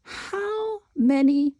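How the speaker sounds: high, slow teacher voice; encouraging teacher; teacher praise. Shown as a demonstration. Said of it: "And you can say".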